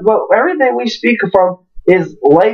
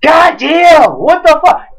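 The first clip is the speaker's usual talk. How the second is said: exasperated outburst; 7 semitones higher, 4 dB louder, slower than usual. Retold or imitated. Telling friends about the pizza guy, an exasperated shout. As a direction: raise the voice, higher, in disbelief.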